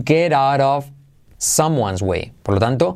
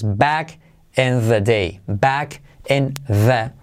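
slow demo; slower than usual. Shown as a demonstration. Teacher slows "Back in the day" for learners. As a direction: stretch "Back in the" slowly. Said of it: "semiclave T H".

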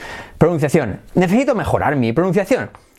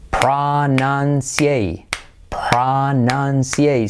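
"Pronunciate" drawn out as PRA-NAN-SI-EI; very slow model of "pronunciate"; slow syllable drill; much slower than usual. Shown as a demonstration. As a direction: stretch each syllable of "pronunciate". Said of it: "Repite conmigo".

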